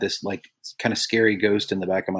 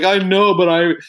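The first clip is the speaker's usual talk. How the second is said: mock sobbing protest; 8 semitones higher, 5 dB louder than usual. Retold sobbing reply to son.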